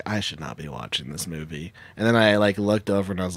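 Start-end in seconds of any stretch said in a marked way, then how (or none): 0.0-1.7 low, very soft mutter; hushed, guilty inner thought; guilty aside. Retold or imitated. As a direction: confess it under the breath.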